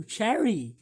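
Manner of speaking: ordinary talk, nothing marked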